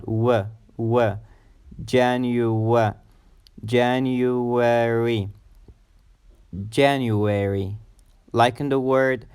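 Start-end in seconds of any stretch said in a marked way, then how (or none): 0.0-7.8 isolated w, then January slowly with a W glide; drawn-out January, third syllable opening on w; W consonant sound. Shown as a demonstration. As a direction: build January up from the w. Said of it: "starts with a consonant sound".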